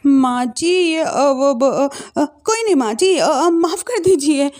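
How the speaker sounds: play-acting flustered stammer; feigned nervousness. Daughter-in-law pretending to panic before mother-in-law, nervous stammer. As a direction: stumble over the words as if caught out. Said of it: "बहू घबराने का नाटक करती है".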